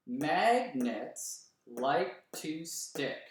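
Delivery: very slow, every syllable separated; clapped syllables. Shown as a demonstration. Teacher claps each syllable of the line with the class. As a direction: beat out "Magnets like to stick" one syllable at a time. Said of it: "You can read along with me".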